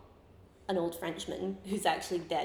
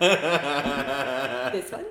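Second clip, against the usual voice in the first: laughing through the quote; 7 semitones lower, 5 dB louder than usual. Retold or imitated. Assistant again, laughing.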